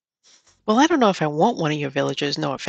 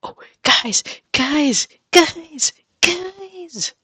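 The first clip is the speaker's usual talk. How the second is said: climbing higher with each guys; rising excited call; 11 semitones higher, much slower than usual. Real, not acted. Gamer breaking the big news to her viewers, rising excitement. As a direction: build the suspense for viewers.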